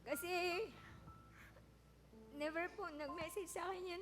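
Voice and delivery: soft, teary voice after crying. Real, not acted. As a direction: speak gently, still choked up from crying. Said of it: "Sorry din kung umiyak ako ngayon".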